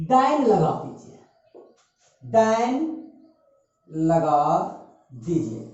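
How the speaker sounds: word by word with gaps; drawn-out note-taking pace; slow dictation. Real, not acted. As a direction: dictate it slowly, pausing between words.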